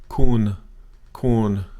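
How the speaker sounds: Kun held level and even; flat tone demo. Shown as a demonstration. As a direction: keep Kun flat, no rise or fall. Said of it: "zero tone marker".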